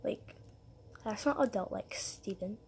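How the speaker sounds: whispered, prim correction; hushed scolding. Retold or imitated. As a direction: softly scold him like a teacher. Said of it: "my stepmom would be like".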